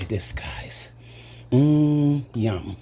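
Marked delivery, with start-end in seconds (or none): 1.5-2.2 loud mmm of delight; loud savoring mmm. Real, not acted. Tasting the meatballs.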